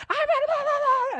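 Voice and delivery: very high, loud scolding gibberish; mock angry mom. Mom turns to scold her daughter, shrill mock rant. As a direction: rant at the kid in the back seat.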